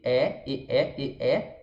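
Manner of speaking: E shown closed, then the mouth opens wider; E sounds, the mouth opening up vertically. Shown as a demonstration. Teacher contrasting the two E sounds for students. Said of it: "Se você comparar com E".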